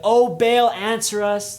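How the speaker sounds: loud, high pleading cry to an idol; desperate shout. Retold or imitated. Prophets crying out to Baal for an answer, loud desperate shout. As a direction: yell it up to the sky, begging. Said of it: "They're shouting".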